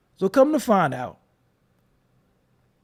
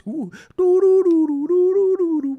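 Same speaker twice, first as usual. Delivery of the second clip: high, louder sing-song doo doo doo; mock humming; 11 semitones higher, 7 dB louder than usual. Streamer mocking chat, high sing-song humming. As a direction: tune it up to mock them. Imitating a sound mid-rant.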